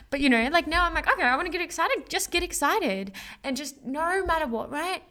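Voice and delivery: high excited self-pep talk; pumped up. Shown as a demonstration. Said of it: "demonstrating choosing excitement".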